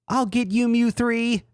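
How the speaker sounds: very high mock-determined falsetto; hero voice. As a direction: squeak the vow. Hero vows revenge.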